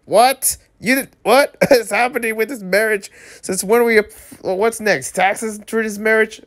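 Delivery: high-pitched baffled outburst; incredulous. Acted. Reader reacting to absurd contract marriage terms, incredulous. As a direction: splutter in high, mock disbelief.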